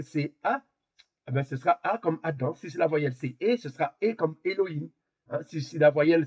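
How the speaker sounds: usual speaking voice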